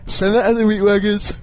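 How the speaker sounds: talking through a yawn; words stretched by a yawn. Real, not acted. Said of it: "Sorry for this yawning".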